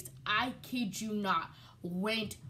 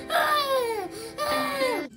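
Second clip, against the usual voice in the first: very high, loud mock wail; mock loud sobbing; loud crying wail; 8 dB louder than usual. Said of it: "all you hear is Amy's loud crying".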